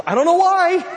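loud, high-pitched outburst; comic bafflement. Real, not acted. Preacher joking about kids sick before church, loud bafflement.

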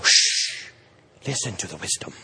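low, soft, self-important shushing; mock pompous hush. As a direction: play the lofty wise man. Mocking self as pompous mentor.

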